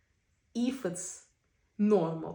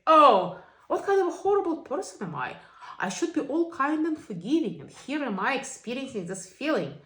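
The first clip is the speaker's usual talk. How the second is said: mock self-blame; 5 semitones higher, 6 dB louder than usual. Voicing self-critical inner talk.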